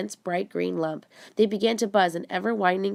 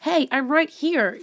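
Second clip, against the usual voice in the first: higher, slow insistent shout; shouting again; 7 semitones higher, 5 dB louder, slower than usual. Acted. Neil shouting to friends who cannot see him, slow higher shout. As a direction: call out, frustrated nobody sees you. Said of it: "Neil shouted again".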